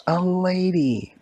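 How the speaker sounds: ordinary delivery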